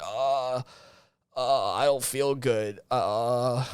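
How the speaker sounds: a slow, quiet, low-energy moan of someone who feels awful; mock sluggish groan. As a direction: drag it out slow and soft like a sick slacker. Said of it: "walking around like".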